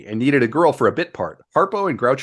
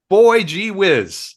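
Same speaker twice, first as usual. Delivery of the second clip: put-on wholesome 1950s kid; 9 semitones higher, 5 dB louder, much slower than usual. Jokey plea to Hollywood agents.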